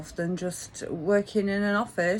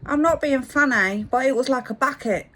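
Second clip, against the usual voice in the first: loud, higher put-on London accent; 6 semitones higher, 6 dB louder than usual. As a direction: complain as a mock Londoner. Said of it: "in his London accent".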